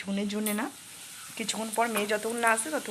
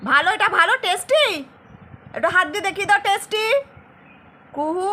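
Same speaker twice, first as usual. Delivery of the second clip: put-on much higher, much louder sing-song baby talk; 13 semitones higher, 15 dB louder than usual. Mother cheering her little daughter eating chaumin, high sing-song baby talk. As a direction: coo and cheer the toddler on.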